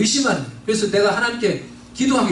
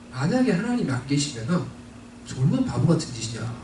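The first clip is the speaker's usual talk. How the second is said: quiet skeptical thought; 7 semitones lower, 6 dB quieter than usual. Retold or imitated. Retold skeptical inner thought.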